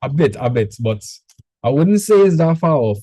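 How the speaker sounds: ordinary talk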